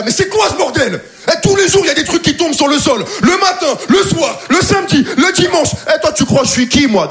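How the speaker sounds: high, heated mock rant; worked-up mock yelling; angry neighbor rant.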